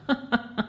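hearty acted laughter; big laugh. A narrator's reading. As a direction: break into a run of laughs. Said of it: "cominciò una gran risata". (gran risata recitata)

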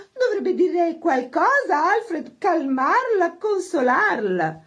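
very high, drawn-out fussing; showily solicitous. A narrator's reading. Isabel consoles the hostess and prods Alfred, oversolicitous. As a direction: smother her with affected sympathy. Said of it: "si affrettò a mostrarsi premurosa".

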